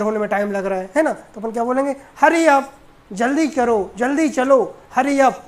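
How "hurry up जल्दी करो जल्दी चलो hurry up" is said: raised, impatient call to hurry; loud impatient urging; urgent hurry. Shown as a demonstration. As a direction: chivvy someone who is running late. Urging someone getting ready.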